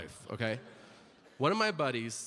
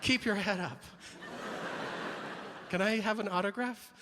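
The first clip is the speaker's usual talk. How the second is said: high, childlike mock fan voice; timid eager fan; 7 semitones higher, 4 dB quieter than usual. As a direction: plead like a starstruck fan, pitched up. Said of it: "picture me in the tunnel".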